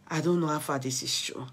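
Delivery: usual speaking voice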